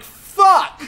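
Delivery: high, loud curse; reliving the moment he found the theft; retold shout. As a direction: yell it out in frustration. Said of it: "And I'm like".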